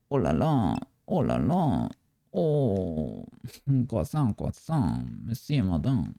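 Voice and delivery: mock French chef rattling off clichés; hammed-up French accent, oh la la; fake French accent.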